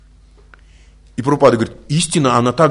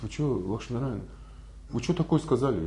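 soft, secretive reproach; hushed devotees; 2 semitones lower, 13 dB quieter than usual. Retold or imitated. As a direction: whisper it like a shocked secret. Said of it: "говорят". (тихо, по секрету, с укором)

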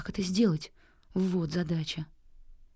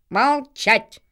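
loud crow order; cawed command; 2 semitones higher, 7 dB louder than usual. A narrator's reading. Crow chieftain silences his horde, harsh loud caw. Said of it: "каркнул Ветрогон".

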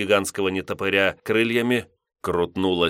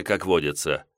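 plain reading voice

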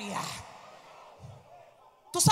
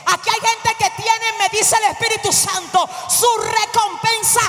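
full-voiced, insistent preaching; fervent preaching; 2 semitones higher, 8 dB louder than usual. Real, not acted. Preacher tells congregation the devil will not embitter them, fervent. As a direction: drive each phrase out to the crowd.

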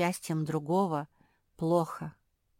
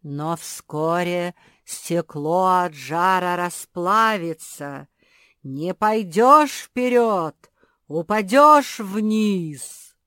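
slow ominous lake voice; 9 dB louder, much slower than usual. A narrator's reading. Lake warns Kuki.

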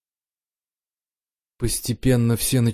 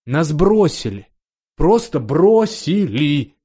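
higher, anguished protest, slowing on the last word; bitter outburst; 4 semitones higher, 4 dB louder, slower than usual. Acted. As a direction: cry out at being abandoned, drawing it out. Young duke's outburst at being abandoned.

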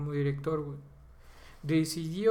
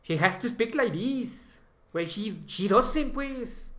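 exaggerated Mexican accent in English, higher and slow; mock Mexican accent; 6 semitones higher, 3 dB louder, much slower than usual. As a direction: ham up a heavy Mexican accent, mocking a bad director. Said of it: "Es como si dijéramos que como Sombra es mexicana". (acento mexicano exagerado en inglés, burlón)

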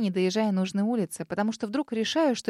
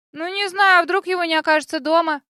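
put-on nervous girl voice; 8 semitones higher, 9 dB louder than usual. A narrator's reading.

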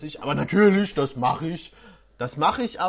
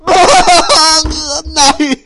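loud laugh bursting out; laughing outburst; 7 semitones higher, 17 dB louder than usual. Real, not acted. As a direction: crack up in disbelief. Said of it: "Was ist diese Scheiße".

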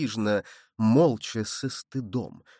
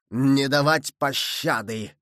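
slow, menacing shout, mildly raised; shouted order; slower than usual. A narrator's reading. Enemy rider answering Winter, shouting slowly. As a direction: draw out the merciless command. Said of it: "крикнул по-французски в ответ".

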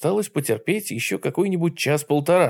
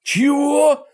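much higher, loud shocked yelp; near howl; 13 semitones higher, 5 dB louder than usual. Acted. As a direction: yelp it in disbelief. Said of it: "едва не взвыл я".

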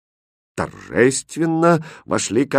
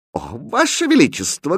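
high, drawn exclamation; raised, animated address; loud exclamation; 7 semitones higher than usual. A narrator's reading. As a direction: exclaim to the king. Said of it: "громко воскликнул Шут".

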